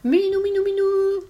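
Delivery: very high, sing-song kitty call; high, sustained calling notes; calling a cat. A narrator's reading. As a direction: coax a cat over in a loud falsetto. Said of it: "et l'appela".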